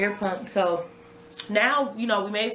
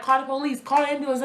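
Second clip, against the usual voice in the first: higher, pressing retold plea; urgent command; 5 semitones higher than usual. Mother telling fiancé to call for help, retold urgently. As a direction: replay the frantic order to get help.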